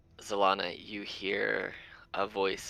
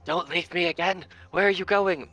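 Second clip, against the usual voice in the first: higher and louder, pleading after her; desperate call; 9 semitones higher, 6 dB louder than usual. Acted. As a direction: call out as she's left behind. Abigail calling after Zalana.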